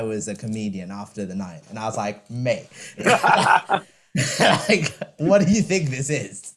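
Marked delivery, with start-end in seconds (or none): 2.3-6.5 laughing, incredulous "mate, what do you think this is"; laughing retort. Retold or imitated. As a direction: scoff through a laugh. Retold reply to audience member.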